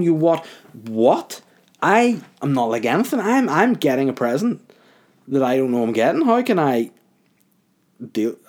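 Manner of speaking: high, emphatic retold disbelief; incredulous protest. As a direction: act out baffled indignation. Retold baffled protest at accusation.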